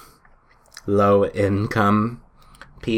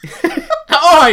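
a burst of high laughter breaking the bit; 6 dB louder than usual. Real, not acted. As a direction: laugh out mid-impression. Laughs during the impression.

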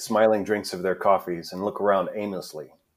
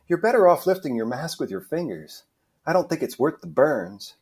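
put-on higher-pitched young-protester character voice; higher, chatty stage-play voice; Melanie, friendly teasing; 7 semitones higher than usual. Plays Melanie in read script.

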